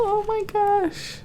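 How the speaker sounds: high gleeful exclamation; delighted. Acted. Reader reacting to happy reunion, delighted. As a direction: squeal it with delight.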